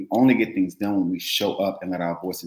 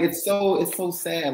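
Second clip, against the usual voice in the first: fighting tears; 9 semitones higher, slower than usual. Real, not acted. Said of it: "I'm trying not to cry".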